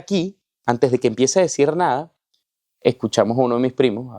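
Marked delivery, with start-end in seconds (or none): 0.0-0.4 high, held-back mock yell; imitated shout. Father starting to call the searchers, high restrained mock shout. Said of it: "cuando empieza a gritar".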